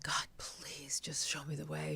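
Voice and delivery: slow, whispery retold prayer; hushed plea. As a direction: plead softly and slowly, drawing out the words. Despondent, pleading with God.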